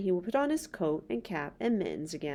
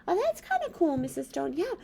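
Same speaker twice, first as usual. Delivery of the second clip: high squeaky puppet voice, fast and eager; a chirpy little puppet character; 9 semitones higher than usual. Acted. Puppet Bob's voice.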